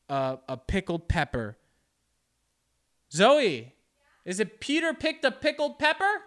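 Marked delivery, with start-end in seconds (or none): 3.1-6.2 loud, high-pitched question; raised voice, checking a phrase; calling out. Real, not acted. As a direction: call to someone out of earshot. Calls to partner to check tongue twister.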